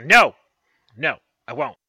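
high, loud flat refusal; sharp refusal. Acted. Witch to the curious bard, a loud sharp no. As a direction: shut the question down hard.

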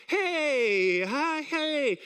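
drawn-out, tentative party hello; self-mocking sheepish entrance; awkward greeting. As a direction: stretch out an uneasy hello on arrival. Awkward arrival at neighbours' party.

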